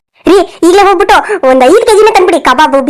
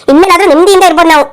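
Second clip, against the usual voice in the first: ordinary talk, nothing marked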